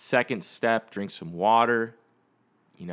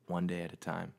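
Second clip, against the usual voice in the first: "One day at a time" much softer and lower, to himself; quiet repeat; 4 semitones lower, 10 dB quieter, faster than usual. Real, not acted. Repeating the mug motto to himself, quietly. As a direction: let "One day at a time" sink in, softly.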